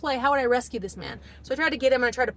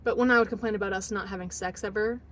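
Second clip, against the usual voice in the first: ordinary talk, nothing marked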